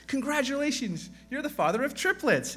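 high sing-song nurse voice; nurse announcing. Acted. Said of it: "another nurse comes up to the second guy and goes".

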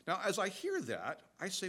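usual speaking voice

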